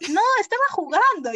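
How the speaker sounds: high, loud nervous brush-off; flustered excuse. Retold or imitated. Her flustered excuse to friends.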